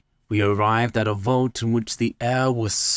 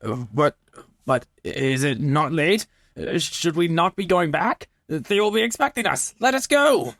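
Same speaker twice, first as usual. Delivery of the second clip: climbing higher and faster into panic; rising panic; 8 semitones higher than usual. A narrator's reading. Chained man begs his captor to go back, panicking. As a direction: beg to leave, voice rising to a cry.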